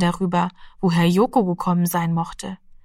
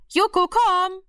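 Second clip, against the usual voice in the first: much higher call, leaning on komm; coaxing call; 12 semitones higher, slower than usual. A narrator's reading. Mother testing if the cat knows its name, coaxing call. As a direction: coax the cat to come.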